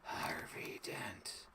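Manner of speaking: slow whispered gravel; hoarse whispered hero voice; Batman gravel voice. Retold or imitated. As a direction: rasp it like the Bat. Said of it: "He talks like that 'cause he's got them gravelly".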